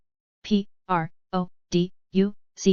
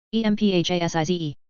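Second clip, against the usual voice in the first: quick letter run; brisk recap spelling; fast spelling; faster than usual. Shown as a demonstration. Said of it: "spelling drill, slow then fast".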